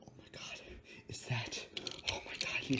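hushed, unvoiced pretend disbelief; play-acted quiet amazement; awed whisper. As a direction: whisper as if stunned by a find. Pretends to discover gold.

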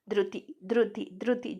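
ordinary delivery

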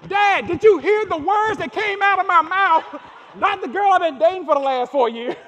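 high, loud, laughing disbelief; shocked disbelief. Retold or imitated. Retelling his shocked reply.

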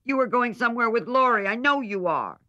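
little sister pouting and insisting; Amy accusing. A narrator's reading.

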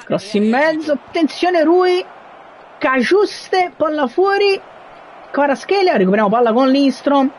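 high, urgent play-by-play; excited commentary. Real, not acted. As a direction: call the scramble breathlessly. Scramble in the box.

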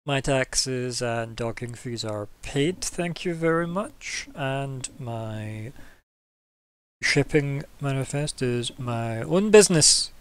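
slow, deliberate, every word placed; captain slowly laying out her rights; methodical and slow. Acted. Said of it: "methodically and slowly".

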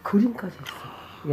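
slow, hushed whisper. Real, not acted. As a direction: whisper it slowly. Spotting a painting in the eerie room.

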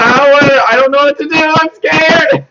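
half-laughing fright as the power drains; panicked. Real, not acted.

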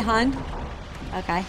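ordinary talk, nothing marked

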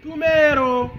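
yelling across the room. Acted. Calling child to food.

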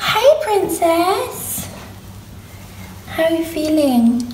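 high, slow sweet pet voice; talking to a pet. Real, not acted. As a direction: greet a beloved pet tenderly. Greeting her pet rabbit.

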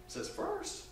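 ordinary talk

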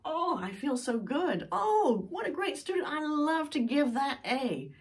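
high, gushing delight over a perfect paper; a teacher's overjoyed squeal of praise. Retold or imitated. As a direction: beam with joy at a top student. Teacher delighted grading an exam.

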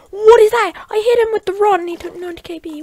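very high, loud, rushed protest; outraged shouting. Real, not acted. Gamer protesting a hit on his opponent, outraged high shouting. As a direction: yell the complaint in disbelief.

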